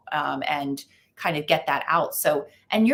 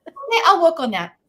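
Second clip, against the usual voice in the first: put-on cheery kid; 11 semitones higher, 8 dB louder than usual. Son answering cheerfully.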